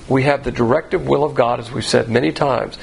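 usual speaking voice

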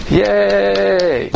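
a sarcastic little crowd cheer; mock cheer. Mock congregation cheering donor.

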